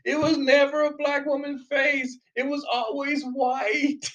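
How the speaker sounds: hammed-up indignant denial, pitched high; mocking denial. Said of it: "compelled to reject this and said".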